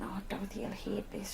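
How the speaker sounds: soft whispered inner thought. Retold or imitated. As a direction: mutter it to yourself. Inner thought at solemn funeral.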